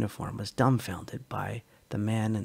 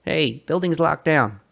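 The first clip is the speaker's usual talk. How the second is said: calling out; 5 semitones higher, 7 dB louder than usual. Acted. Kid calls out to stranger.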